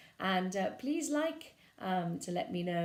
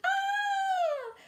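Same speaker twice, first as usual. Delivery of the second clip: high wailing cry; witch's wail; 6 dB louder than usual. Acted. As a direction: let out a long witchy wail. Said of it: "how the witch wailed".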